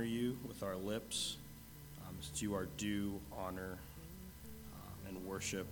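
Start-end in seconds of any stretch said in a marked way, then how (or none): none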